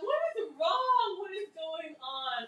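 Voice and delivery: slow, high, fading bewilderment; slow, bewildered pleading to the chat. Real, not acted. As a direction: drag out the disbelief, trailing off. Cook baffled by chat.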